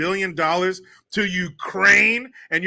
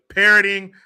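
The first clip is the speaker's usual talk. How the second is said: usual speaking voice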